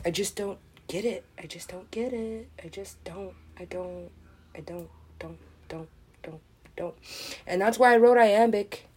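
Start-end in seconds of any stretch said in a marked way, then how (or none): 0.1-6.9 dwindling, stuck-record murmur; fading repetition. Acted. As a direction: trail away to almost nothing. Repeated line fades into a pulse.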